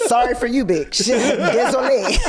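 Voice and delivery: high, loud laughing taunt; mocking laugh. Guest imagines pitying a stranger, laughing high. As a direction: cackle a mock pity.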